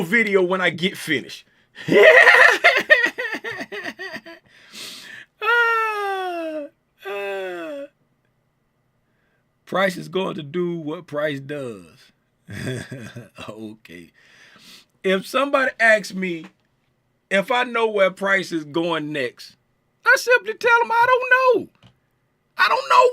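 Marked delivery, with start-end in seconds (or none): 1.8-4.3 a burst of high, loud laughter. Real, not acted. Tim laughs at his own threat to delete the video, loud high laugh. 5.4-7.8 mock cry. Mock cries after the laugh. 20.0-23.1 emphatic answer. Real, not acted. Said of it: "Tim delivers his punchline answer".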